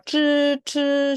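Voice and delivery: 쯔 said high and tense as Mandarin zhi; 쯔 as retroflex zh, tongue curled back; retroflex demo. Shown as a demonstration. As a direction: model the curled-tongue 쯔 for learners. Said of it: "제가 한번 따라 할게요".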